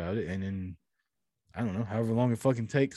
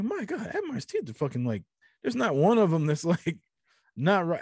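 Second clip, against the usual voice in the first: amazed; 7 semitones higher than usual. Retold or imitated. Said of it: "I was like".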